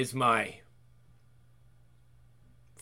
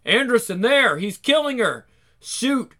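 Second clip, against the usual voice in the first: urgent calling out; 7 semitones higher than usual. Acted.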